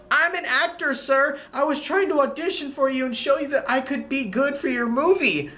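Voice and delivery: lightly voiced actress making her case; pleading actress. Actress explains her audition to the director, pleading.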